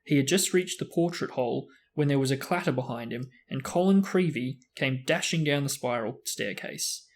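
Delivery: ordinary delivery, nothing marked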